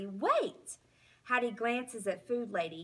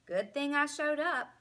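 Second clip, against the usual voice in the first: ordinary delivery, nothing marked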